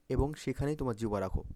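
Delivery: normal talking voice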